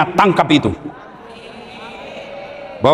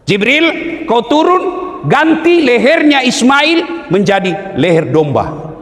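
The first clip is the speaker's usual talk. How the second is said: commanding divine voice; 9 semitones higher, slower than usual. Acted. Voicing God's command.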